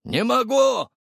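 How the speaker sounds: high-pitched shouted refusal; excited shouting. A narrator's reading. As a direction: call it out, pitch way up. Driver shouts back while speeding.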